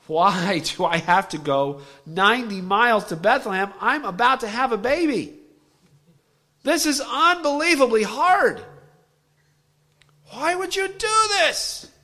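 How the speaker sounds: high, loud mock complaint as a weary Mary; Mary whining. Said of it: "to whine".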